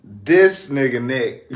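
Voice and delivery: ordinary talk, nothing marked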